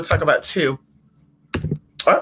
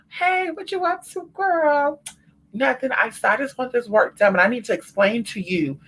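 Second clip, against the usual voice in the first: high sweet-talking voice; 7 semitones higher than usual. Retold or imitated. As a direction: sweet-talk her way high. Imitating sweet-talking client.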